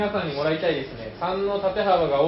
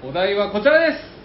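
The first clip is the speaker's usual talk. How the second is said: ordinary delivery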